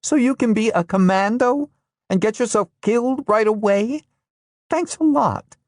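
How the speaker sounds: very high, sarcastic furious outburst; fury peaking into bitter sarcasm. Acted. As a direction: hurl the sarcasm at him. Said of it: "suddenly furious".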